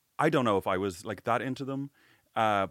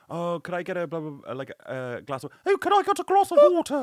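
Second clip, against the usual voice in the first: much higher and louder, a hammed-up posh accent on the last ask; mock posh foreign accent; 8 semitones higher, 7 dB louder than usual. Ordering water in Dublin in a hammed-up posh accent. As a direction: lay the fancy accent on thick to mock yourself. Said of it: "they think I sound like".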